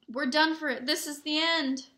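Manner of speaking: loud, high despairing wail; moaned. A narrator's reading. Ron helpless at a locked door, wailing. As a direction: moan it as if all is lost. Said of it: "Ron moaned as they pushed helplessly".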